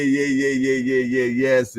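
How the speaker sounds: ordinary talk, nothing marked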